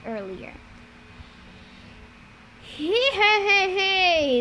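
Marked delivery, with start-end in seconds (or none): 2.6-4.4 high-pitched hee-hee laugh. A narrator's reading. Bear-man henchman laughing at the talk of lunch, high giggle. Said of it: "his brother Orius laughed".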